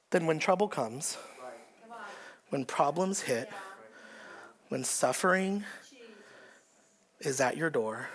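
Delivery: hushed preacher's warning; grave hush. Acted. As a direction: drop the voice to a solemn near-hush. Sermon drops to grave hush.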